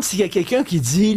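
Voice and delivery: ordinary talk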